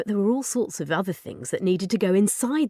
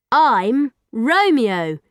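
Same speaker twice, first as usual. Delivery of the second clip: very slow, louder mechanical robot; 5 semitones higher, 6 dB louder, much slower than usual. Acted. Robot naming itself for the first time, slow and mechanical. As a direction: speak it like a machine learning words.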